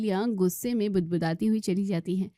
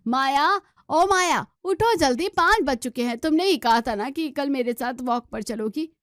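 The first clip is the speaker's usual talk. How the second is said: much higher, loud call through a door; 5 semitones higher, 4 dB louder than usual. Acted. Mother-in-law calls daughter-in-law awake through the door, loud. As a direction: wake her up through the door.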